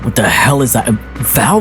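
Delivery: ordinary talk, nothing marked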